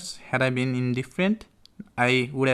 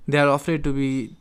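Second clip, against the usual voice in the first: ordinary talk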